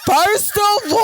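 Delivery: playful indignant outburst; high protesting shout. Real, not acted. Protesting the teasing.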